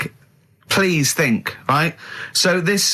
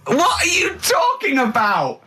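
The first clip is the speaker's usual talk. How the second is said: exasperated shout; 12 semitones higher, slower than usual. Real, not acted. Ricky exasperated at Karl's story.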